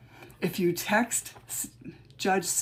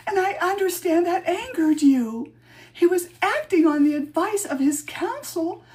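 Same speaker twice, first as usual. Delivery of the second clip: much higher, louder melodramatic witch; hammed-up wailing witch, pitched up high; mock witch voice; 9 semitones higher, 4 dB louder than usual. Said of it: "I'm gonna change my voice a little bit".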